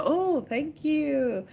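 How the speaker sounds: high, very slow drawn-out thanks; gushing thanks. Real, not acted. Thanking a viewer for the couch compliment, high and drawn out. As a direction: stretch it out sweetly high.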